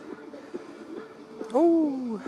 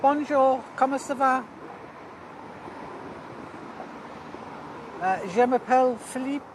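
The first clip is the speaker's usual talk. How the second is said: higher, hammed-up French accent intro; mock French; 5 semitones higher than usual. Introducing himself to chat in mock French accent. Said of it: "Fifty-two says bonjour".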